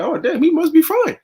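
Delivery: high, airy, unbothered mock; mock relieved parent. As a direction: shrug it off in a high voice. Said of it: "you're gonna be like".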